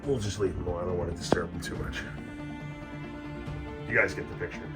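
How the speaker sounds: hushed so the snakes stay undisturbed; soft, careful voice while filming; wildlife watching whisper. Real, not acted. Zooming in, not disturbing snakes.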